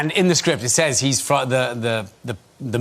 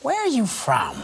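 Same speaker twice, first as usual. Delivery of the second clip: Peter Falk; 3 semitones higher, 3 dB quieter than usual. Retold or imitated. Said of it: "And then he goes".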